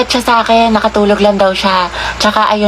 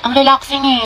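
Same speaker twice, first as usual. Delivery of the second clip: ordinary talk, nothing marked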